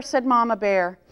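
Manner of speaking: ordinary delivery, nothing marked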